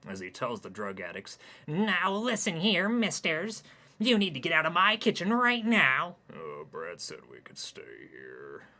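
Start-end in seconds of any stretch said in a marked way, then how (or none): 1.7-6.2 goody-goody scolding. Acted. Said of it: "goody-goody mode". 6.3-8.6 low, slow, soft strung-out groan; groaning junkie. Acted. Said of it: "groaned one of the junkies".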